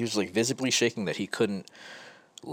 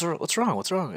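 high, urgent worried question; a parent's alarmed call, pitched way up; worried parents asking; 11 semitones higher than usual. Retold or imitated. Parents running to a crying child, alarmed and high. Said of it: "came running over and goes".

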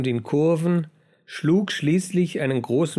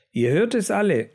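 mild cry to the whole pack; raised, higher voice, calling all to witness; geschrien; 6 semitones higher than usual. A narrator's reading. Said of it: "schrie der schlaue Mäuse Josef". (verhaltenes Schreien, höhere Stimme)

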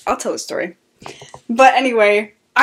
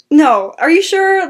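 higher, louder, slower pleading "are you sure"; mock flustered protest; 7 semitones higher, 6 dB louder, slower than usual. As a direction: protest kindly, flustered and embarrassed. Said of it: "she was like".

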